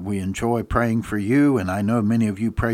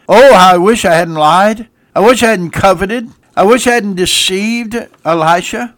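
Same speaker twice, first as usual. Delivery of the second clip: loud, high wailing regret; anguished lament; 8 semitones higher, 10 dB louder than usual. Acted. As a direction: cry out in remorse. Gehazi's anguished cry.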